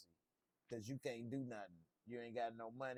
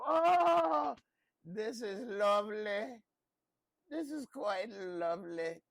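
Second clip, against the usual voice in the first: high, loud gushing delight; Papa Tarly impression; 8 semitones higher, 9 dB louder, slower than usual. Recapping the show, mimicking Papa Tarly's high delighted gush. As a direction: squeal it out like a giddy Samwell type. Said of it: "That's my Papa Tarly impression".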